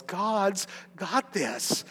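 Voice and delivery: hushed slow reassurance; reassuring. Real, not acted. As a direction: settle the room with calm. Repeated reassurance.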